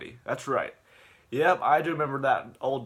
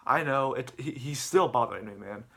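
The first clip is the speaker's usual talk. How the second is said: ordinary delivery, nothing marked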